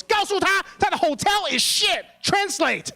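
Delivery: high, loud angry shouting; mad mom. Retold or imitated. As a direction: imitate a furious mother bellowing at a hotel manager. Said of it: "my mom got mad".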